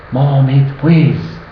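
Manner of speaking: lightly put-on Chinese accent; mock Chinese accent. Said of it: "in his best accent".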